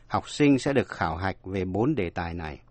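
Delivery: normal talking voice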